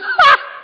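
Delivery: high, loud burst of laughter; acted laughing fit. Abraham cracking up at God's promise of a son, high-pitched acted laughter. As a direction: crack up in a big mock laugh. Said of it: "he just starts laughing".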